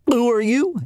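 high, eager little frog; lifted, bright frog question; young frog voice. Acted. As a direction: ask it like a curious small frog. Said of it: "asked Frog".

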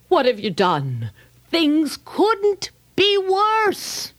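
a whiny, dragged-out moan; drawn-out high complaint at Moses; complaining Israelites. Acted. Israelites whining to Moses, slow high moan.

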